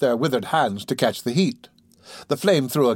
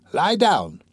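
raised repeat; 5 semitones higher than usual. A narrator's reading. Repeats the order to the patient.

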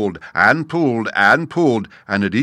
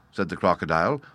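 usual speaking voice

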